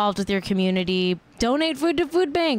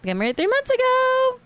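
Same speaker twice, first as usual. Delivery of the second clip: much higher, squealed fake astonishment; mock shock; 14 semitones higher than usual. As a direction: repeat the news like it's scandalous. Mock-shocked repeat of the news.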